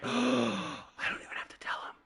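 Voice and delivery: hushed, breathless whisper after a gasp; excited whispered aside to himself. Retold or imitated. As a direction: whisper it like a secret thrill. Retold thought, gasp before.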